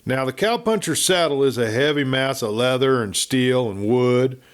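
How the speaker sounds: ordinary delivery, nothing marked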